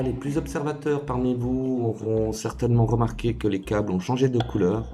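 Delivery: usual speaking voice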